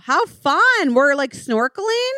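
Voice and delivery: high-pitched, hammed-up thrill; gushing delight. Co-host plays witch pushed into the ocean, gushing delight. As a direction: squeal with mock excitement, voice way up.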